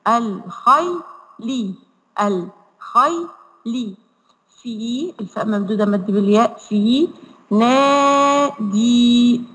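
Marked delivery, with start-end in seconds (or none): 0.1-4.0 الخيلي stretched slowly with the ياء held still; very slow syllables. Shown as a demonstration. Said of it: "الخاء والياء مقطع ساكن". 7.5-9.4 نادي with both the ألف and the ياء long and held; long vowels. Shown as a demonstration. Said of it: "هنا مقطعين مد".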